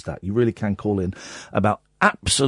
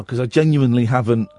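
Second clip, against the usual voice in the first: ordinary delivery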